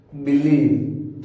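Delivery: बिलीव drawn out slowly, long ee in the middle; teacher's slow demo of बिलीव; slow model word. Shown as a demonstration. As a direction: stretch बिलीव as bi-leev with a long ee. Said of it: "बड़ी ई पढ़ना है".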